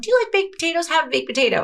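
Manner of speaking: high hopeful coaxing. Retold or imitated. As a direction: coax her like a child to eat. Coaxing mom to eat.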